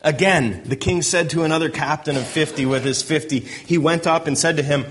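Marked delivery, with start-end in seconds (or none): none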